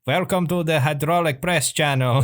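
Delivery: mock Finnish accent, like the hydraulic press guy. Shown as a demonstration. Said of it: "They sound a little bit like this".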